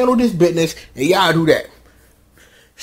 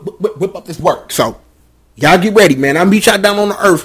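normal talking voice

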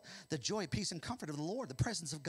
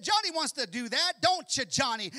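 loud, high teasing sister voice; sing-song tattling big sister; sly sister; 7 semitones higher, 8 dB louder than usual. Acted. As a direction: sister volunteering her brother, high and teasing. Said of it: "And Sally said".

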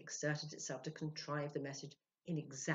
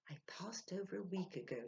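whispered reading aloud; soft reading; 3 semitones higher, 4 dB quieter than usual. A narrator's reading. Sylvia reading the manuscript in a whisper. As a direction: read it back under the breath. Reading the message back softly.

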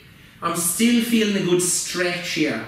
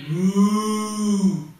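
playful mock cow moo for the kids. Yoga teacher to children in cow pose, playful mooing. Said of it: "breathe in".

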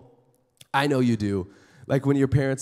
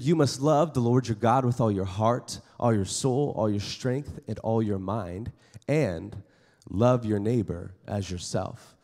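scripture reading; 6 semitones lower, 4 dB quieter than usual. Acted. Reads scripture passage aloud.

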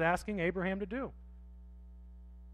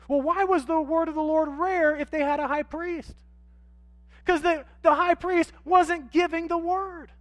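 loud, high preaching swell; full-force preaching; 8 semitones higher, 7 dB louder than usual. Acted. Pastor to congregation, loud impassioned preaching. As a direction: preach it out with rising fire.

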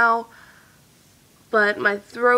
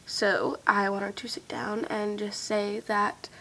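slow, hushed and sincere; whispering; 7 dB quieter, slower than usual. Real, not acted. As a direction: whisper it slowly to your fans. Sincere message to her viewers.

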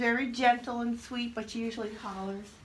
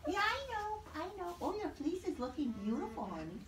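cooing to alpaca; 6 semitones higher than usual. Real, not acted. Coos to a pregnant alpaca.

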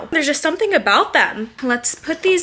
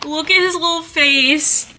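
overwhelmed by cuteness; 6 semitones higher than usual. Real, not acted. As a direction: squeal over his little face. Seeing new baby photos.

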